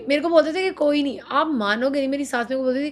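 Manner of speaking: normal talking voice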